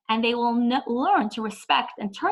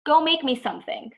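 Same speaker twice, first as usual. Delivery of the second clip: higher, louder bossy command; bossy order; 6 semitones higher, 4 dB louder than usual. Retold or imitated. Child ordering parent to make food, bossy demand. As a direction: boss the parent around. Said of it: "they cannot order you around".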